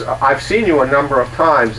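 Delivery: ordinary delivery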